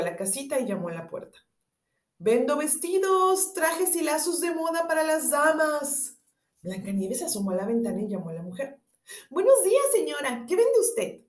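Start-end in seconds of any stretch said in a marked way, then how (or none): 2.2-6.1 high, slow hawker's call; old peddler voice. A narrator's reading. Disguised queen peddling. 9.3-11.2 put-on high, loud sweet girl; girlish voice. A narrator's reading.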